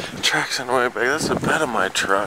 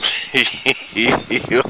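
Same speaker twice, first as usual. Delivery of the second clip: real laugh. Real, not acted. Stunned by deep snow.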